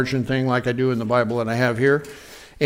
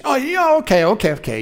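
backing down; 10 semitones higher than usual. Retold or imitated. Bully backing off.

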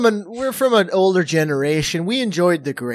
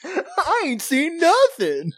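slow, high mock drawl; Tarantino impression; 11 semitones higher, much slower than usual. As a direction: ham up Tarantino's way of talking. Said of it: "'Cause that's how he talks".

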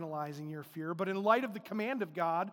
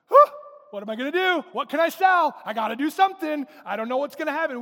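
louder, higher frantic money panic; panicked worry; 6 semitones higher, 11 dB louder, faster than usual. Acted. Broke believer panicking over the bank account, frantic and loud. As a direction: blurt it out like payday is too far away.

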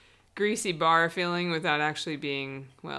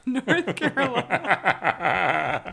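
laughing through the words, drawn out; 4 semitones higher, 5 dB louder, much slower than usual. Real, not acted. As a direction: crack up loudly while stretching the words out. Cracks up at own slip.

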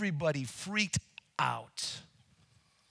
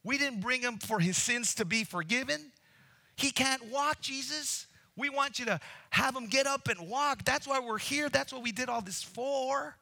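high, loud protest from the roof; hammed-up outraged friends; indignant friends; 9 semitones higher than usual. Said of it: "saying out loud".

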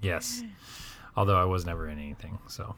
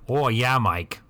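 put-on Midwest accent; 4 semitones higher, slower than usual. Grandparent outraged at the Mexican market, in a high Midwest accent. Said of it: "the Midwest accent is real".

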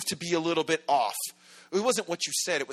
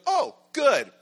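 relieved; 9 semitones higher, 5 dB louder than usual. Acted. Relieved reply in acted exchange.

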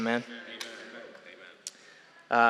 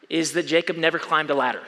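ordinary talk, nothing marked

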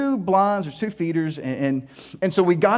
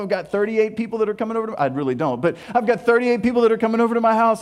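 ordinary delivery

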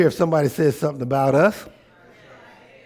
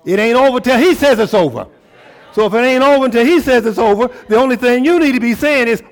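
loud high fervent preaching; shouted fervent sermon; preaching at full force; 9 semitones higher, 9 dB louder than usual. Real, not acted. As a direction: build the church to its feet.

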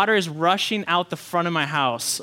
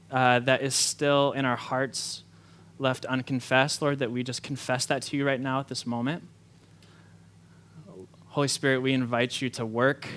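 prayer; 5 semitones lower than usual. Acted. Opening prayer before the sermon.

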